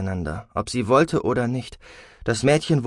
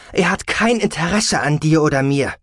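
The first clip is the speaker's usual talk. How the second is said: loud, raised, emphatic voice; exasperated mother, pitched up; angry insistence; 7 semitones higher, 6 dB louder than usual. A narrator's reading. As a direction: hammer it home to the daughter.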